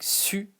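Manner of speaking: "su" with tight front rounded u; French u sound. Shown as a demonstration. Teacher showing learners the vowel to imagine, French u. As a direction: say "su" with lips rounded, tongue forward. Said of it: "imagine something like".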